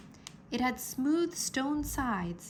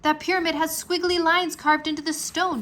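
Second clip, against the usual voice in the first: higher, louder excited voice; excited pointing out; 7 semitones higher, 6 dB louder than usual. A narrator's reading. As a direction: keep the thrill of discovery. Drake excited, pointing out lines.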